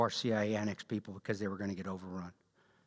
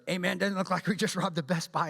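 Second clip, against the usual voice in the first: high, mocking Chicano stoner accent; buddy teasing him in a Cheech Marin accent; 7 semitones higher than usual. Fellow Ranger mocks him mid-run, Cheech Marin accent. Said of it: "I speak like Cheech Marin".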